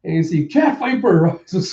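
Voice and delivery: usual speaking voice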